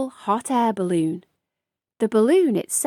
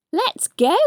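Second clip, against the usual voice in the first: a bright, happy burst; excited; 7 semitones higher, 4 dB louder than usual. A narrator's reading. Scotty agrees to go home, excitedly. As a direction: say it like a puppy ready to run. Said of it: "said Scotty excitedly".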